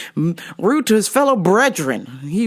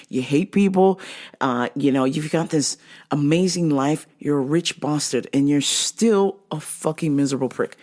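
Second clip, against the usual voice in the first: ordinary delivery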